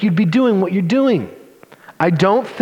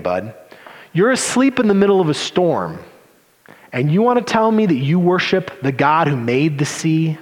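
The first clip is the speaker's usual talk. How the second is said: ordinary talk, nothing marked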